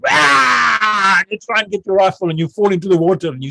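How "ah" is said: high, loud acted war yell; battle cry. Trainee on the rope reacting to trigger words, battle cry. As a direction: yell out in instant attack.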